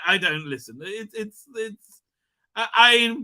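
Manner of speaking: normal talking voice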